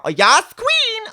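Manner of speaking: very high, loud, slow drawn-out squeal; sassy squeal. Retold or imitated. Mocking trendy slang, a very high slow squeal. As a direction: squeal it like an over-the-top fan. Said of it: "they're only short of speaking like this".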